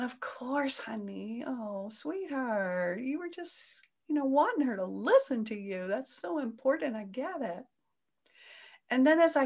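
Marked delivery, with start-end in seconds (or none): 0.0-7.6 softer, tender comforting voice; soothing. Retold or imitated. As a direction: soothe the hurt part gently. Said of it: "And so I'm going".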